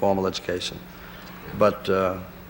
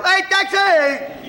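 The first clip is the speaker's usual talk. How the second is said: very high, slow drawn-out yell; hailing a taxi; 6 dB louder, much slower than usual. Retold or imitated. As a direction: stretch the call out like hailing a cab. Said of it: "I yell".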